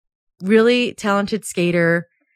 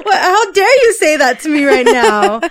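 laughing mock outrage; 8 semitones higher, 7 dB louder than usual.

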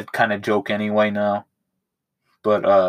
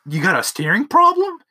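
much higher, louder sneering replay of the threat; mocking the movie line; 12 semitones higher, 4 dB louder than usual. Brother repeats the narrator's threat, mocking parody. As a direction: parrot the line back like it's ridiculous. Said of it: "that one movie line of".

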